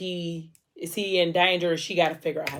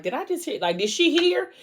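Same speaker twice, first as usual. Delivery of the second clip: mock freaking out; 10 semitones higher than usual. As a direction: voice leaping high in retold panic. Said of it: "So he freaking out".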